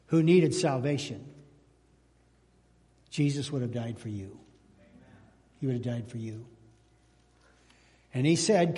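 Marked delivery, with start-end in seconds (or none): 3.1-6.5 tender, soft. Real, not acted. Pastor softens on key line.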